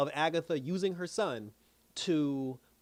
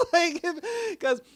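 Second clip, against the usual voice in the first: laughing; 8 dB louder, much slower than usual. Real, not acted. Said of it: "laughs at own joke".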